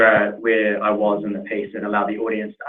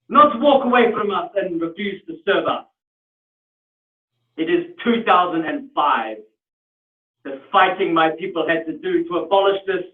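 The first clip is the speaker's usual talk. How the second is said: outraged customer in a higher South African voice; indignant South African; 7 semitones higher than usual. Acted. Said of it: "that was a, a very good accent".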